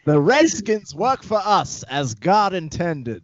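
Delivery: hammed-up evil Southern accent. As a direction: drawl it like a pompous Southern colonizer. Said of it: "The Southern accent can go evil so quickly".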